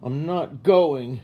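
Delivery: loud, slow, dug-in no; stubborn refusal. A narrator's reading. Girl under the covers refusing her father, loud and slow. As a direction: refuse to budge, drawn out.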